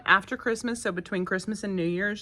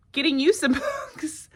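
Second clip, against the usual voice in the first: high, a laugh breaking into the words; laughing promise; 9 semitones higher, 4 dB louder than usual. Retold or imitated. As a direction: tell the child through a laugh. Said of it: "I was like".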